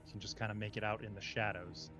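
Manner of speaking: slow, measured dungeon description; scene setting. A narrator's reading. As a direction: paint the room for the players. Game master's scene setting.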